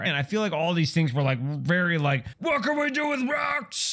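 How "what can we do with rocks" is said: mock caveman shout. Imagined ancients wondering about rocks.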